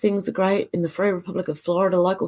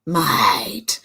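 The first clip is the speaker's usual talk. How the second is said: whispered; 5 semitones lower than usual. Pretend. Whispered mate.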